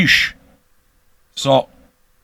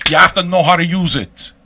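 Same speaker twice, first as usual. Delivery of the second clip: emphatic lecture; 5 semitones higher, 6 dB louder than usual. Real, not acted. Lecturing listeners on tough love.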